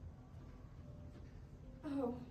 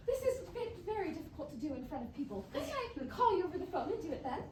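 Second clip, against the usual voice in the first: ordinary talk, nothing marked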